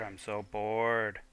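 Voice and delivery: slow drawn-out "bored" sinking down; bored drawl. Acted. Character complaining of boredom, slow bored drawl. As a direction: stretch out "bored" like a sulking kid.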